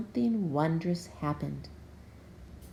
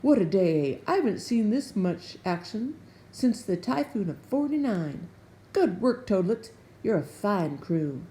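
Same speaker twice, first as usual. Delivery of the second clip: jolly sea captain; 5 dB louder than usual. A narrator's reading. Jolly captain praising crew.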